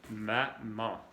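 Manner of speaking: ordinary talk, nothing marked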